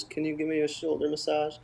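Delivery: a hint of a tired mom asking for help; mother asking. Mother asking her kids.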